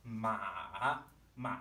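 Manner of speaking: "Ma" starts low, dips, then comes back up; dipping third tone. Shown as a demonstration. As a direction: sink "Ma" to the bottom and lift it. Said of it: "producing a good third tone".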